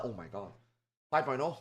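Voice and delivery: ordinary talk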